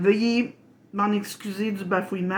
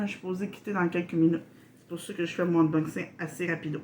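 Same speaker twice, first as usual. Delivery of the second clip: rushed, hurried speech; racing through the words, short on time; quick rambling pace; talking fast; 3 dB quieter, much faster than usual. Real, not acted. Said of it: "Je parle assez vite".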